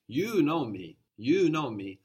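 "You" punched high and loud, falling away after; emphatic YOU. Shown as a demonstration. Teacher prompting student to translate, emphatic stress on "You". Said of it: "Using the word for you to be emphatic".

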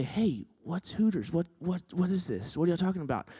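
curious classmate asking quietly, not making a scene; leaning over quietly. Retold or imitated. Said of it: "a normal human being would lean over and say".

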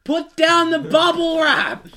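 high, loud, slow order; commanding shout. Real, not acted. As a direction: bellow the command. Ordering friend to stop popping.

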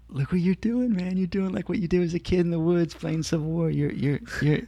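giddy inner voice. Retold or imitated. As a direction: hype yourself up like a kid. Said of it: "getting a giddy feeling like".

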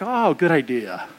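low, slow dry sarcasm; mock-grudging good idea; sarcastic agreement. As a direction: drawl it as if it's a terrible idea. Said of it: "I'm like".

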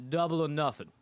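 put-on Polish accent. Polish center raising the bet after dunking, mock Polish accent. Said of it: "in his Polish voice".

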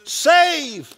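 high, loud, slow imploring prayer; fervent plea. Acted. Pastor voicing the people's plea to God, fervent. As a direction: beg for rescue with full voice.

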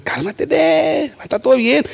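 soothing, stretched-out "calm down". Retold or imitated. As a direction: imitate someone gently talking down a panicker. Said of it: "me dice Lorenzo después".

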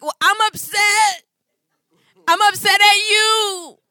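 put-on high, slow, loud tearful kid shout; crying child. Mother imitating her son crying at her. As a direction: wail it like an upset little boy. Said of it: "he's mad".

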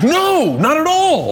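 very high, squealed "No, not at all"; high mocking voice. Voicing himself, mocking deaf woman.

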